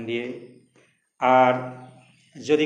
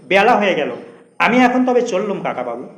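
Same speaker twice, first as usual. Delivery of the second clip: taking leave; 6 semitones higher, 8 dB louder than usual. Acted. Young man taking his leave.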